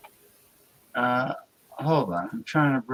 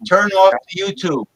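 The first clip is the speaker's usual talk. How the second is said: higher, louder command; loud order; 6 semitones higher, 8 dB louder than usual. Real, not acted. Host telling Jordy to kill the echo, loud. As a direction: tell him firmly to turn it off. His voice echoes back.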